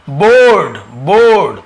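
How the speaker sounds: बोर्ड loud, with a stretched O; बोर्ड said emphatically, falling at the end; demonstrated word sound. Shown as a demonstration. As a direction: teach बोर्ड with a long BO. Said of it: "बो का ध्वनि".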